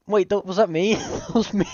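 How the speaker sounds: high, laughing disbelief; real laughing. Real, not acted. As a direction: crack up while asking. Cracks up at own mix-up.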